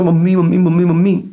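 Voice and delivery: calling out for mom in a panic; retold scream for mom. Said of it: "मैं चिल्लाया जोर की".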